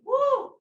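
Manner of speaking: loud, high whoop of exertion; effort whoop. Real, not acted. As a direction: whoop as the muscles burn. Exertion whoop during leg lifts.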